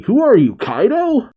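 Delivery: high-pitched, exasperated question; mock-incredulous, climbing high at the end. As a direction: ask it in disbelief, pitch leaping up. Luffy's acted protest at unbeatable enemy.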